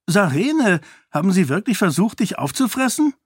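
high, fast, excited question; aufgeregtes Kind. A narrator's reading. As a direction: blurt it out in high-pitched disbelief. Kaise springt auf den Stuhl, fragt entsetzt. (aufgeregt und ungläubig fragen)

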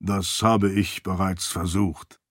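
slow, low, measured interjection; deferential attendant. A narrator's reading. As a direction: correct the lord politely and unhurriedly. Archie continues in attendant's voice. (ergebener Diener, bedächtig und tief)

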